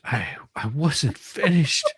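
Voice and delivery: slow, pointed "I wasn't finished"; playfully scolding the interruption; mock offended. Host mock-scolds guest for interrupting, slow and pointed. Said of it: "wait, that was a perfect example".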